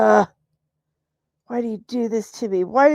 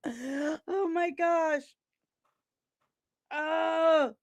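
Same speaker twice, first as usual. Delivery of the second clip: mock wail; 7 semitones higher, 7 dB quieter, much slower than usual. Real, not acted. Laughing cry over eyebrows.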